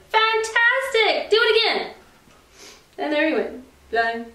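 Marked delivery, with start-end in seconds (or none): 0.1-1.9 high, slow gushing cheer; excited shout. A narrator's reading. Princess urging the tired dragon, high. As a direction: egg him on with fake delight. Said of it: "but Elizabeth shouted".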